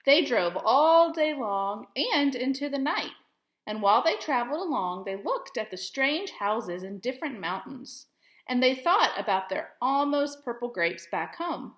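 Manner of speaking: gentle storybook reading voice; expressive picture-book read-aloud; reading to children. Acted. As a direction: read it like story time for young kids. Teacher reads picture book aloud.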